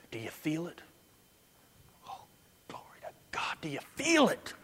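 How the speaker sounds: whispered, breathless mock awe; mock hushed whisper. Preacher mocking churchgoers who call the Spirit it, whispered. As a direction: hush it like a worshipper in awe.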